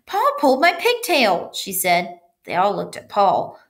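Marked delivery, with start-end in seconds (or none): 0.1-1.5 high-pitched, upset schoolgirl; upset tattling. A narrator's reading. Girl reporting the boy to teacher, upset tattling. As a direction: tell on him in a high voice. Leslie tattles after screaming.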